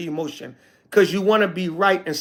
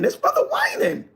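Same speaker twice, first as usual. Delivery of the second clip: high whining impression of the brother; mock him in a high whine; whiny mock voice. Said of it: "why is this brother whining?".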